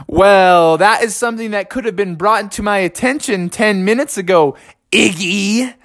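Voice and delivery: sarcastic retort. Acted. Bubby annoyed at Iggy.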